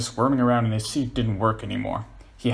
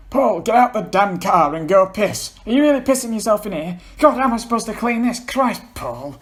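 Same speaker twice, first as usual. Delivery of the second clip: angry; 11 semitones higher, 7 dB louder than usual. Acted. John angry and disgusted.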